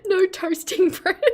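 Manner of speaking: high-pitched words squeezed through laughter; helpless real giggles; giggling. Real, not acted. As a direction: try to say it while cracking up. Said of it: "I'm gonna get the giggles now".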